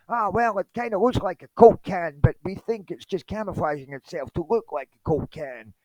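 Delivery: high-pitched mocking guest voice; Jeremy Corbell. Jeremy answering the news host about footage, mocking high voice. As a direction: send him up in a high, silly voice. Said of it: "Jeremy, what do you have to say".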